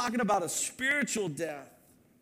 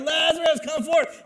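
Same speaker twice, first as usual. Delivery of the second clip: high, loud ringing call; loud command; 9 semitones higher, 9 dB louder than usual. Acted. Preacher voicing Jesus commanding Lazarus, loud ringing call. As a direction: summon him by name with full force. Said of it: "he names him".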